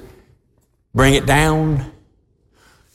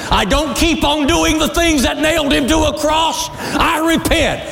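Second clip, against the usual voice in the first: fiery preaching; 12 semitones higher than usual. Acted. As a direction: preach it full force to the crowd. Preaching at full force.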